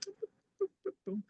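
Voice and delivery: very high, soft mimicked beeps; little high-pitched gadget boops; imitated beeps. Retelling the photo being sent, soft high imitated beeps.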